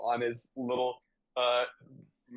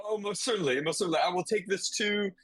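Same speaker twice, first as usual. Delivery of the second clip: eager attendant voice; 6 semitones higher, much faster than usual. Acted.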